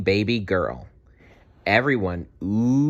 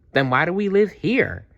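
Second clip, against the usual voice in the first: put-on young dino; 10 semitones higher than usual. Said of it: "asked Dazzle".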